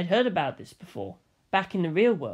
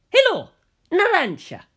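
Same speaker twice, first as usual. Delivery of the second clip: much higher, bright hello; Bucciolati's cheerful greeting; 12 semitones higher, 7 dB louder than usual. A narrator's reading. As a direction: greet your friend brightly on the phone. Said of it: "Bucciolati said".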